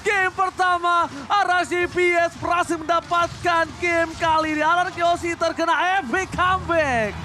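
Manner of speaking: high, loud, drawn-out hype call; fighting game announcer. Acted. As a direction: announce the comeback win at full hype. Caster calls the game-winning play.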